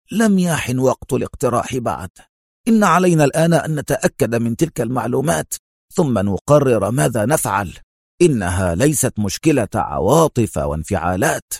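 ordinary delivery, nothing marked

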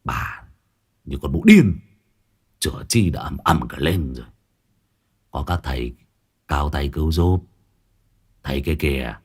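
low, quiet grumbling. Acted. Ông Hỏa grumbles at his wife, low and quiet. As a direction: grumble it out under his breath. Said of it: "cằn nhằn".

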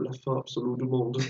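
softly imitated foreign accent in French; lightly put-on accent, quietly repeated film title; Norman Reedus's accented French. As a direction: mimic an actor's accented French, low-key. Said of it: "c'est vraiment Norman Reedus qui répète".

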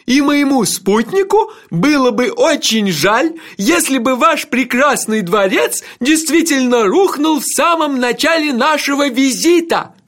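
high, slow, ear-splitting proclamation; loud ceremonial hollering; shouted courtesy. A narrator's reading. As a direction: yell polite regrets with drawn-out grandeur. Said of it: "Сэр Кофа кричал так".

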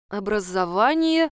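usual speaking voice

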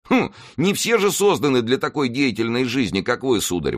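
high-pitched, protesting servant's voice; objecting servant. A narrator's reading. Servant objects to his master's taunt, protesting. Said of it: "возразил Планше".

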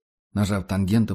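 ordinary delivery, nothing marked

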